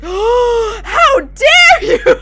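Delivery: very high, loud, drawn-out mock outrage; outraged little kid. Streamer imitating the insulted kid, outraged. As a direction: imitate an affronted child, stretching it out.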